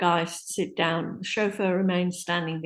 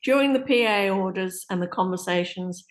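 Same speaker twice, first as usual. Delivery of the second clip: ordinary talk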